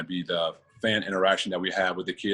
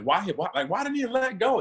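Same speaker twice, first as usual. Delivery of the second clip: high, incredulous mock question; mock commenter; 14 semitones higher than usual. Quoting World Star commenters who doubt his choice, high incredulous mock. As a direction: soar up like a baffled online commenter. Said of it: "That was one of the comments".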